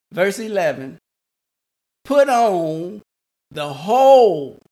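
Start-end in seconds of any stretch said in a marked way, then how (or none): 2.0-4.6 "whole" drawn out and much louder; stress on whole. Shown as a demonstration. Preacher stressing a word of scripture, emphatic "whole". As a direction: punch and stretch the word "whole". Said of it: "I want to emphasize that word whole".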